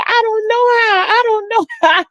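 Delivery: high, mock-helpless little sister; high faked can't-do-it; fake helpless whine. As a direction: pretend not to know how.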